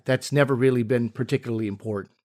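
ordinary delivery

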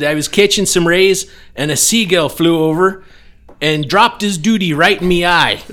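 loud, high pirate bellow; booming pirate voice. Acted. Pirate telling the insurance agent how he lost his leg, booming. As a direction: boom it like an old sea pirate. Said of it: "the accent".

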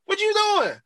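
very high-pitched acted disbelief; incredulous mock protest. Host retelling rescuers confronting the man in shackles, high-pitched incredulous protest. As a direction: squeal it in mock indignation. Acts out characters' astonished exchange.

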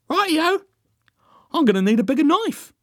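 very high, loud, chirpy agreement; eager realisation. A narrator's reading. Derek grasps Joan's plan, eager realisation. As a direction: pipe up high as the penny drops.

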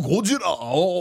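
Gojira drawn out in mock Japanese style; fake Japanese accent. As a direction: Gojira with a pseudo-Japanese lilt. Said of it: "auf Pseudo-Japanisch wiederholen".

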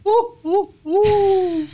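put-on very high, slow quavering old-lady voice with a titter; aristocratic English grandmother. Host imitating aristocratic grandmothers who always laugh. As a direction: play a posh ancient grandma. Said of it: "like, old, like, aristocratic grandmothers".

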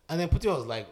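ordinary delivery

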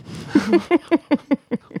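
high, bubbling giggle; laughing. Real, not acted. Co-host tickled by an adorable gushing fan, giggling. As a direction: burst into a long, high laugh. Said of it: "Why are you laughing?".

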